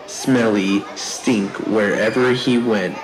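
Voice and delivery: ordinary delivery, nothing marked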